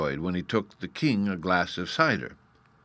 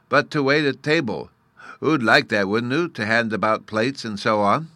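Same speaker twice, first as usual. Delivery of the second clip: put-on louder, higher childlike voice; little fairy boy, eager; 4 semitones higher, 5 dB louder than usual. Bruno offering the narrator waiting work, eager little fairy. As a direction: coax brightly in a small boy's lisp.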